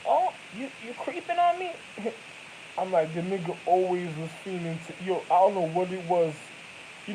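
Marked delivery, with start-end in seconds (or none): 0.1-1.7 high squeaky accusing voice; confronting the ex, high mock voice; mock accusation. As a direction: whine it out, mock-shocked. Mocking accusation, high pitch.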